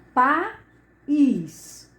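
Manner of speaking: "país" broken into pa, pause, ís; syllable by syllable. Shown as a demonstration. Teacher showing where to separate the hiatus, "país" split in two.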